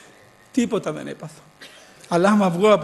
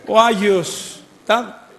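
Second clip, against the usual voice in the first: ordinary delivery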